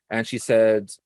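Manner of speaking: ordinary talk, nothing marked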